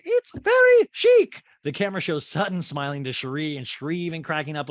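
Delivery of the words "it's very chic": much higher, louder, slow put-on socialite voice; high loud slow caricature of a gushing rich woman; Dorit voice. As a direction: gush it in a high, loud mock-posh voice. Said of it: "And Dorit's like".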